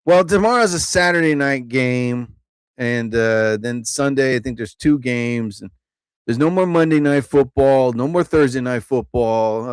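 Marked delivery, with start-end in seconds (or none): none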